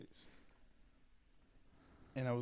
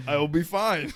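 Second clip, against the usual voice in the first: loud cracking laugh, much higher and louder, then the words laughed out; 9 semitones higher, 19 dB louder, slower than usual. Real, not acted. Joking to his friend about staying sober, laughing loudly. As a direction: burst out laughing through the line.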